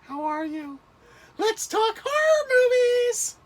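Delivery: mock impression of a friend, slow at the end; Stephanie impression. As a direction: squeal it out as Stephanie. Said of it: "It's Stephanie".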